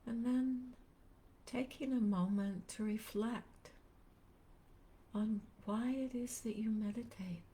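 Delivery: unhurried meditation guide, long pauses between phrases; calm, spacious guiding voice; guided meditation. Real, not acted. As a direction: gently lead listeners into stillness, leaving space after each phrase.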